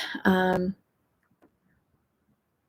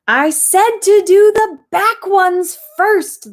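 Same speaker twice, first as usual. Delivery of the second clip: higher, louder, slow crocodile call; 12 semitones higher, 7 dB louder, slower than usual. A narrator's reading. Crocodile calling to the far-off dentist, loud and high. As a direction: boom it across the room like a pushy croc. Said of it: "the crocodile called out".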